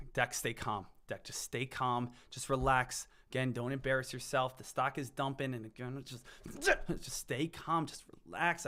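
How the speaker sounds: calming himself. Real, not acted. Talking himself down during drop.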